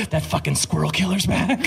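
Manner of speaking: mock-alarmed fellow passenger; lightly voiced grumble into a phone; imitated phone caller. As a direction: report the nuisance like an exasperated commuter. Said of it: "on the phone being like".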